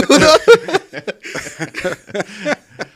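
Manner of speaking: long loud rising laughter. Real, not acted. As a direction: keep laughing at the joke. Laughs at own joke.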